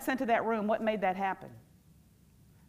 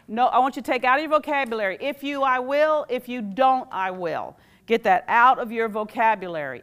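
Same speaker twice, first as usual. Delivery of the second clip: emphatic order; 3 semitones higher, 8 dB louder than usual. Real, not acted. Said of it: "coach stressing a rule".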